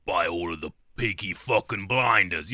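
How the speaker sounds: put-on British gang-boss voice; Peaky Blinders accent. Shown as a demonstration. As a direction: imitate a Peaky Blinders accent, low and measured. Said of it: "only way I can do accents is im-, is imitating someone".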